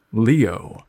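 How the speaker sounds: plain reading voice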